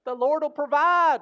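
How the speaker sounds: the do-nothing prayer person by the burning church, hammed-up piety; mock pious prayer type. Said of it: "and they just go".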